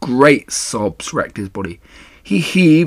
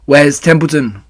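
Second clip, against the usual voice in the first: loud, slightly higher question; urgent demand; 4 semitones higher, 7 dB louder than usual. A narrator's reading. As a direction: demand an answer at once. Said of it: "he demanded".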